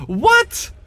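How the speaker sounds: sudden high shocked gasp. Real, not acted. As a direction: gasp it out, stunned. Shocked gasp at a game miss.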